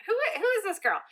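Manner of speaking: high, mock-stunned question; amazed disbelief. Boyfriend stunned by her honesty, high and amazed. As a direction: play his jaw-drop moment.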